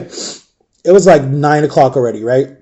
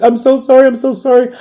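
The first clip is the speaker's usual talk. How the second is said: high-pitched frantic apology, acted out; mock-tearful high confession; imitated scared pleading; 7 semitones higher than usual. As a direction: plead like a scared friend owning up. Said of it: "Like, she was so scared".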